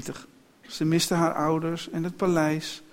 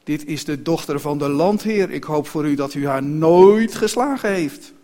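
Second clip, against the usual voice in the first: stern warning, growing louder; angry; 8 dB louder than usual. A narrator's reading. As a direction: sternly warn her as the indignant coachman. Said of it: "En boos zei hij". (boos en steeds luider waarschuwen)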